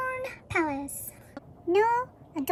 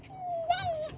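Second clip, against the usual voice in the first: high little yay; a happy unicorn cheering; soft cheer; 9 semitones higher, 9 dB quieter than usual. Acted.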